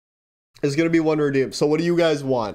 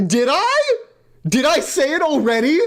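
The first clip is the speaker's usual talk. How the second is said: sudden high disbelief; shocked; 10 semitones higher, 3 dB louder than usual. Real, not acted. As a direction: gasp it out in shock. Shocked realization.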